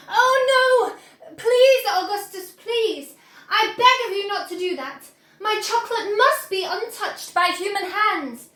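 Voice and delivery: high, loud pleading cry. Acted. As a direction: beg him desperately to stop. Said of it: "he cried out".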